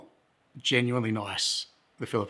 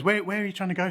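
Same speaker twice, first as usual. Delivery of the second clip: higher-pitched imitation of a curious local; helpful local man; 8 semitones higher than usual. One of the drinkers asks the lost visitor, high helpful voice. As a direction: play the inquiring stranger, pitched up.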